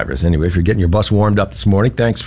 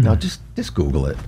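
lower, softer parental brush-off; dismissive parent; 7 semitones lower, 6 dB quieter than usual. Acted. Parent answering a homework question, low offhand dismissal. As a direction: wave the kid away, low and quiet.

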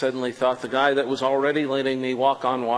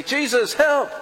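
pleading cry; 13 semitones higher, 5 dB louder, slower than usual. Pretend. Imagined cry for help.

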